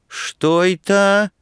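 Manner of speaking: high, drawn-out haughty query. A narrator's reading. Student answering the woman who warned him, haughty. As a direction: look down on the speaker with a slow question. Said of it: "высокомерно взглянул на неё студент".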